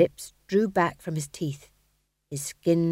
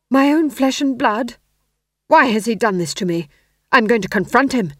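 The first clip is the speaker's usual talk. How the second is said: loud, high voice of an aggrieved king; a wounded king rising to anger; king's outburst; 5 semitones higher, 8 dB louder than usual. Acted.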